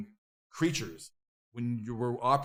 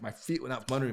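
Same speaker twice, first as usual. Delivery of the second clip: ordinary delivery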